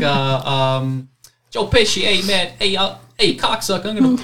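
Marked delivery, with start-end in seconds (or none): 1.5-4.2 put-on higher, louder Italian-American mobster accent; rough New York Italian tough-guy accent; Joe Pesci impression. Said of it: "something like this".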